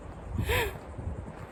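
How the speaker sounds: sharp high gasp of fear; frightened gasp. Real, not acted. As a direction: catch your breath in panic. Frightened on trail.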